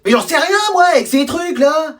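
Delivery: high, exasperated self-defence; flustered protest. Acted. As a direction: throw up a high protest at the gadget. Flustered teacher blamed over phone. (protestation agacée, voix aiguë)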